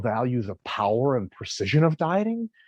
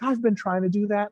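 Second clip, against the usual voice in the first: a lightly voiced, higher prying office coworker; mock the busybody colleague, lightly; a hint of a condescending coworker, pitched high; nosy coworker; 8 semitones higher than usual. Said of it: "And she's like".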